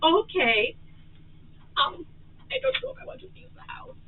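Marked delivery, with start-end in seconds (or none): 2.5-3.9 stage whisper. Acted. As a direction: whisper it for the mics. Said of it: "Stage whisper".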